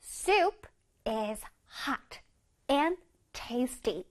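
very slow, each word drawn out; slow model reading. Shown as a demonstration. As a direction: read slowly for the kids to copy. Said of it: "시작".

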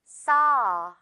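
saw said slowly with an open ah; model saw. Shown as a demonstration. Teacher modeling saw for students to repeat. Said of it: "듣고 따라해주세요".

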